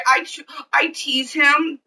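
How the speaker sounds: normal talking voice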